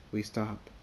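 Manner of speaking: ordinary delivery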